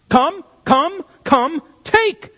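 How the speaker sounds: short, high-pitched urgent imperatives; terse, urgent commands. Shown as a demonstration. Preacher showing the congregation the verse's urgency, terse commands. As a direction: fire off each command as a separate urgent order. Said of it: "Its very terseness conveys a sense of urgency".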